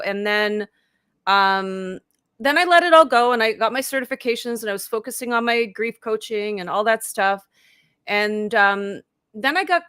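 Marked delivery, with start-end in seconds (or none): none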